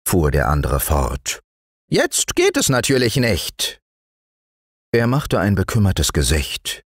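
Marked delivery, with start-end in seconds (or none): 1.9-3.8 high gnome voice, disappointed; tiny man's rueful refusal; regretful little dwarf. Acted. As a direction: withdraw the treat in a small high voice. Dwarf regretfully withdraws his plan.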